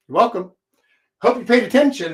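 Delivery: ordinary talk, nothing marked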